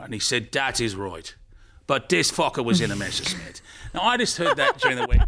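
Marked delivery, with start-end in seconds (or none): 0.5-3.6 put-on Irish accent for a joke's punchline; Irish grandfather. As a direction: land the punchline as an old Irishman. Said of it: "The accent's what makes it funny".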